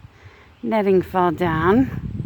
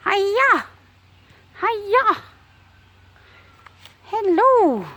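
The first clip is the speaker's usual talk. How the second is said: much higher, bright calls to the bird; high cheerful chicken calls; high pet greeting; 11 semitones higher, much slower than usual. Real, not acted. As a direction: sing out a hello to a pet.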